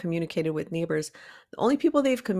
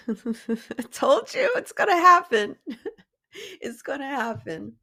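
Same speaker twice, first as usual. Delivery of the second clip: higher, louder burst breaking into laughter; laughing; 7 semitones higher, 4 dB louder than usual. Real, not acted. Speaker tickled that "I told y'all" came true, laughing. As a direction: say it through real laughter. Cracks up at herself mid-talk.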